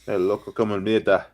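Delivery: put-on Spanish-accented playful line; mock Spanish. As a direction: toss it off in a jokey Spanish accent. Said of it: "you did it pretty great".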